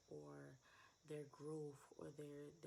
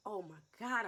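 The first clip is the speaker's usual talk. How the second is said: shocked gasp; 4 semitones higher, 13 dB louder than usual. Real, not acted. Shocked by her own words.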